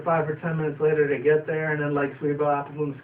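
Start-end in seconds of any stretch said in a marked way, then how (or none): none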